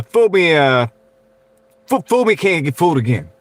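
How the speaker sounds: halting, high-starting Texan politician voice; George Bush impression. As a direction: stumble through it like a folksy president. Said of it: "My Bush impression's probably not as good".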